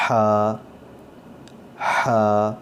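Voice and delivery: ہا breathed from the middle of the throat with pressure. Shown as a demonstration. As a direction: push air from the throat for ہا. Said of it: "حلق سے آواز آنی چاہیے". (حلق سے دباؤ کے ساتھ ہا)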